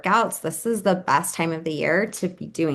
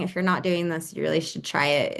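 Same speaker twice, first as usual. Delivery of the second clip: normal talking voice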